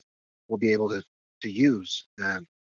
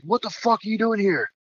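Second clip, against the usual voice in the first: mock angry yell; 9 semitones higher than usual. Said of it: "This guy was like".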